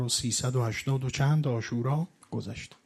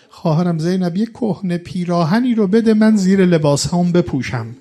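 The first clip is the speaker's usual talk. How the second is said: «خواهرم زینب» called out louder and higher; pleading call; 4 semitones higher, 9 dB louder than usual. Retold or imitated. Imam calling to his sister Zeinab for an old shirt, louder. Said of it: "فرمود".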